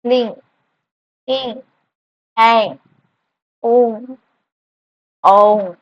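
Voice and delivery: each nasal sound said alone, with pauses; nasal vowel demo. Shown as a demonstration. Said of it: "जैसे". (हर अनुनासिक स्वर अलग बोलना)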